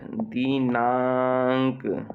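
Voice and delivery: दिनांक stretched out very slowly; slow dictation. Shown as a demonstration. Teacher dictating to pupils, slowly spelling out the word. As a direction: draw out दिनांक for the class to write. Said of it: "तो अम की मात्रा न में आ जाएगी".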